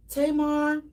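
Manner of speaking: ordinary talk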